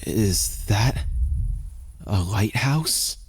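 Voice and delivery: hoarse, slow croak; croaky voice. A narrator's reading. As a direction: croak it out in awe. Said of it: "my voice a croak".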